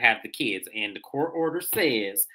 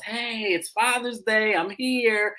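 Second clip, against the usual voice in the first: mock Ralph; 8 semitones higher, slower than usual. Ralph arriving, voiced by teller.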